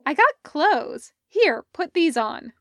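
Parker's voice. Acted. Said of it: "she said".

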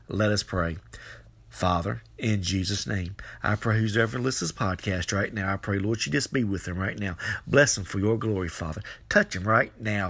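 low, earnest spoken prayer. Real, not acted. Leads listeners in prayer.

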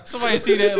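talking through laughter; words squeezed out mid-laugh. Real, not acted. Laughing at movie reaction.

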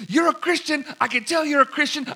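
imitating the girl who won't take no; insistent girl.

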